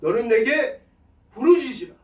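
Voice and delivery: loud, high, forceful cry of scripture. Shown as a demonstration. Pastor shows crying out to God in prayer, loud fervent cry. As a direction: call it out as a fervent plea. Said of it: "부르짖어 기도하는 거예요".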